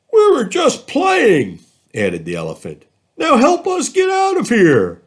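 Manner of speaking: insist, then demand rescue; pleading elephant. Acted. Elephant begging the boys to pull them out, high and loud.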